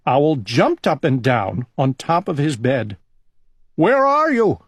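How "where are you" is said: much higher and a bit louder, alarmed; a storybook owl crying out in alarm; frightened cry. A narrator's reading. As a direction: call out into the dark in fright. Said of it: "he cried".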